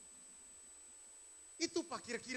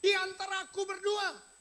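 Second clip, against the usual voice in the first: louder, higher anguished cry; crying out to heaven; 8 semitones higher, 6 dB louder than usual. Acted. Job shouting his complaint up to God, loud and high. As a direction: call up to the sky in protest. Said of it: "Dia berteriak ke langit".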